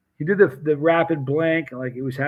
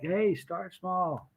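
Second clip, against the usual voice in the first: slow, softer friendly caution; gentle warning; 4 semitones higher, 7 dB quieter, much slower than usual. Retold or imitated. Vendor warning a boastful customer, slow and soft. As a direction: ease them down gently. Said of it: "we're like".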